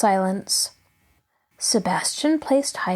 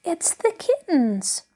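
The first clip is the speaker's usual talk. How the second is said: put-on child explaining; 8 semitones higher, slower than usual. A narrator's reading. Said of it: "Heidi at last was able to say loudly".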